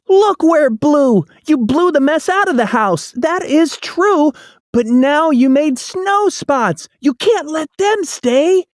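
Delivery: put-on a child telling off the cats; scolding boy. A narrator's reading. Boy voice in read-aloud.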